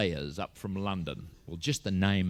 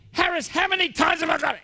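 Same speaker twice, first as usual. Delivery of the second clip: very high, loud yell; exasperated teacher's shout; 6 dB louder than usual. Acted. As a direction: cut the pupil off with a screech. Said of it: "they shout at you".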